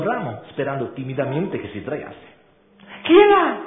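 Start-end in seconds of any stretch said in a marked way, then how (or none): 3.0-3.6 high startled shout. A narrator's reading. Female chameleon calling out at an intruder, loud and high. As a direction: call out sharply into the leaves. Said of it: "gridò".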